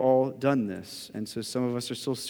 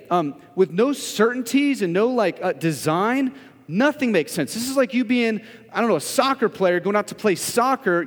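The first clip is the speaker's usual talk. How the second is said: louder, urgent pulpit voice; full-force preaching; 7 semitones higher, 7 dB louder than usual. Acted. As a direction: press the point home to the congregation.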